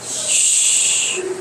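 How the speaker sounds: long, soft unvoiced "shh"; combined SH digraph. Shown as a demonstration. Teacher combining S and H for class, one SH sound. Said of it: "Pag i-combine".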